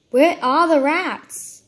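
loud, slow exasperated demand; frustrated. Real, not acted. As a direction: drag it out in exasperation. Frustrated searching in game.

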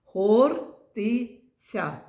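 ХОРТИЦЯ very slow, split ХОР, ТИ, ЦЯ; syllable by syllable. Shown as a demonstration. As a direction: count out ХОРТИЦЯ in three separate beats, first one loudest. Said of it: "Скільки тут складів?".

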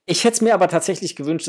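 usual speaking voice

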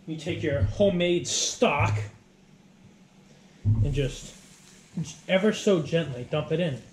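ordinary talk, nothing marked

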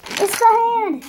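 high, loud, urgent warning; panicked warning. Acted. Lego figure warns the others that the hand is back, panicked. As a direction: sound the alarm.

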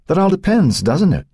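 Cynthia, knowing and cold. A narrator's reading. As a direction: toy with the doctor. Cynthia's voice voiced.

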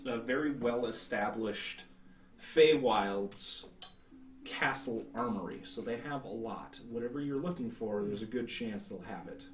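normal talking voice

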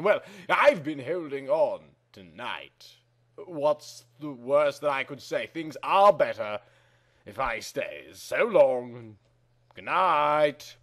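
normal talking voice

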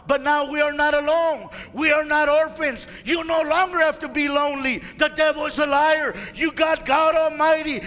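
rally the congregation; full-force preaching. Acted. Pastor rallying believers that they are not alone, full-force preaching.

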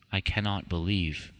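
ordinary delivery, nothing marked

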